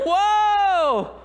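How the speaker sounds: high, loud, wide-eyed whoa; amazed whoa. Real, not acted. Pastor marvelling to the congregation at the record, amazed. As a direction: let the wonder burst out.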